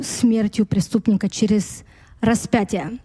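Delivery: normal talking voice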